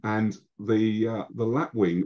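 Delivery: ordinary talk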